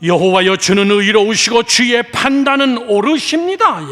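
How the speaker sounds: loud, drawn-out declaration to the Lord; fervent proclamation. Acted. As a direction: declare it slowly, rising to a high finish. Proclaims a prayer of praise.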